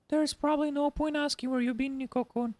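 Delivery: put-on high, sweet young woman's voice; high girlfriend voice. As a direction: voice her high and girlish. Narrator voices Himitsu.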